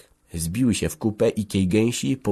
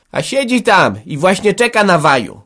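high, loud grumbling jibe; muttered sarcasm; 11 semitones higher, 8 dB louder than usual. A narrator's reading. Hanka mocking the men searching, sarcastic grumble. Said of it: "mruknęła".

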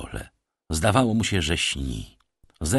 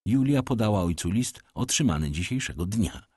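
plain reading voice

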